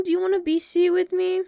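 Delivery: ordinary talk, nothing marked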